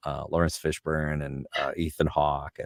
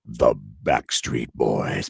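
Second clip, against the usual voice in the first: much lower, slow announcer voice; trailer voiceover; 9 semitones lower, much slower than usual. Shown as a demonstration. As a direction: intone it like a concert trailer. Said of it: "It was like".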